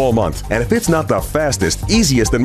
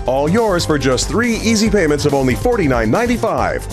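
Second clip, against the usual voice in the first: upbeat hard-sell announcer; infomercial; 3 semitones lower than usual. Acted. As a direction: pitch it like a TV ad. Infomercial pitch over music.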